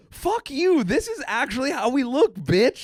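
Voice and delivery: high, brash mocking shout; mock defiant retort. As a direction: imitate the Mexicans clapping back. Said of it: "they were just like".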